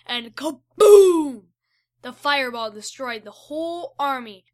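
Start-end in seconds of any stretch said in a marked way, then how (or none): 0.4-1.4 booming, drawn-out explosion word; big kaboom. A narrator's reading. Narrator relishing the fireball blast, booming. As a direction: let the kaboom blast out slowly.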